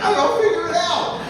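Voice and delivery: high, loud comeback; acted wife's impatient yell; exasperated retort. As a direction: shout back and hand him the problem. Wife shouts back at husband.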